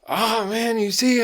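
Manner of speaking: put-on high-pitched whining upset boy; upset kid. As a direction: whine it high like a flustered kid. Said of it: "his face was all red".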